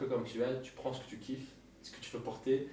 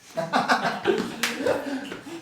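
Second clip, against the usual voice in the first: long high burst of laughter; 13 semitones higher, 9 dB louder than usual. Real, not acted. Host laughing after the guest adds baby to his slogan. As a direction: let the laugh ride up.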